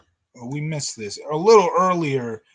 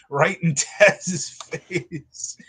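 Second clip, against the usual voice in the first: usual speaking voice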